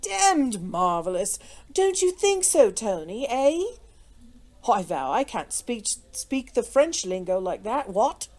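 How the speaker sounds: unruffled aristocratic banter; imperturbable. A narrator's reading. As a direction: breezily marvel to a chum. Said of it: "continued Sir Percy imperturbably".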